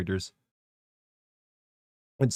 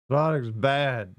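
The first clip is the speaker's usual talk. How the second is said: put-on old man voice; 3 semitones higher, much slower than usual. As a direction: rant like a grumpy ninety-year-old. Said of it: "I will be like a ninety-year-old man".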